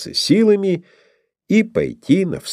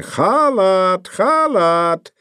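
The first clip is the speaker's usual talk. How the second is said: high ringing war call; battle cry; 9 semitones higher, much slower than usual. A narrator's reading. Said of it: "татарский боевой клич". (боевой клич)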